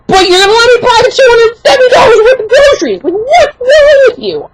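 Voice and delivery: high-pitched, exasperated ranting at a clerk; indignant outburst. Retold or imitated. Customer to cashier over gift card refusal, indignant outburst. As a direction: raise the pitch sharply, stretch the last question in disbelief.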